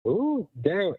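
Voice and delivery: ordinary delivery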